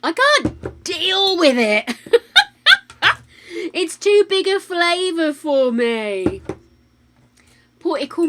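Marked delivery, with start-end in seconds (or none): 0.0-6.4 slow, high laughing words. Real, not acted. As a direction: laugh through the line. Laughing at the sour sweet.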